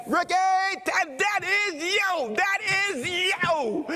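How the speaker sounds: high, slow, stretched-out hollering; yelling across the room. Retold or imitated. Old man recognizes the preacher across the room, yelling. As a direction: imitate an old man's excited high yell. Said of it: "he's yelling it across the room".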